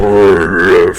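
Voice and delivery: loud rolled engine roar; rough bus engine. Acted. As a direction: rumble like a rough Glasgow bus. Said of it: "That's how the buses go".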